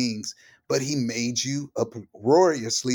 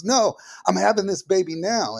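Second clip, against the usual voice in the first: urgent wife; 8 semitones higher, 4 dB louder than usual. Retold or imitated. Retelling wife in labour.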